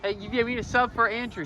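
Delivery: high, fast call across the field. Real, not acted. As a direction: call it out to the bench. Calling across field for sub.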